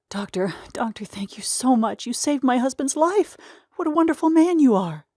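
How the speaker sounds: high, effusive grateful woman; grateful wife voice. Acted. Grateful wife thanks the doctor.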